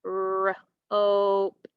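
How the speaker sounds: ROPED split as rrr... ohp-t; sounded out. Shown as a demonstration. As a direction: stretch ROPED, pausing after the r. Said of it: "What's the new word".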